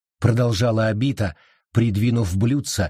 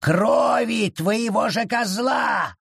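high, slow shouted creature voice; furious creature; 12 semitones higher, slower than usual. A narrator's reading. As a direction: throw it in her face. Forest shouts.

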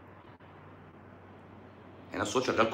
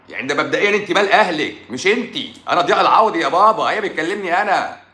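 high-pitched, heated telling-off; indignant scolding; 7 semitones higher, 4 dB louder than usual. Real, not acted. Scolding a commenter who meddles in medical advice, indignant. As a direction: tell her off, pitch pushed up.

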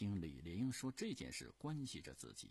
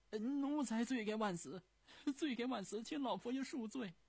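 求饶; 6 dB louder than usual. Acted. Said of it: "不住地磕头求饶".

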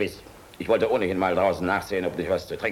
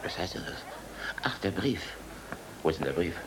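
stunned whisper; 3 semitones lower, 7 dB quieter, faster than usual. Acted. General's stunned reaction.

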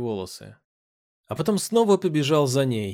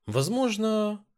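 higher-pitched, drawn-out word; 9 semitones higher, much slower than usual. A narrator's reading. Man teasing the princess with a hedged answer, drawn out. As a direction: stretch it out, lifting the pitch. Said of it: "протянул".

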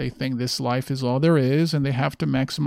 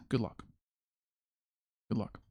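sarcastic good luck; 3 semitones lower, 13 dB quieter, faster than usual. Real, not acted. Sarcastic send-off to the state.

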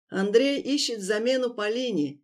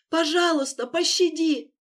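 begging; 9 semitones higher, 4 dB louder than usual. Acted. On knees begging.

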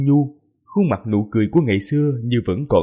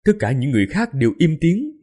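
ordinary delivery

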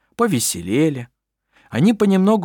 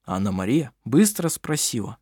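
ordinary delivery, nothing marked

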